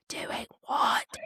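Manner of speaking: hushed question; breath-only stage whisper; acted whisper. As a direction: whisper it, acting out the character. Voices the character's hushed question.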